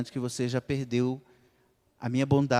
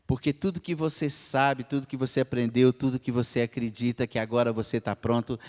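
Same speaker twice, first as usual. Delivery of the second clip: normal talking voice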